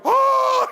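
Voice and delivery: very high, loud imitated crowd cry. Audience answering the drumstick order, high loud mock cry. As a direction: whoop like a stunned, thrilled audience.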